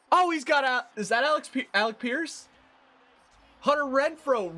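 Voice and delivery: high, loud live call; real excitement calling the catch; rising play-by-play call; excited play call. Real, not acted.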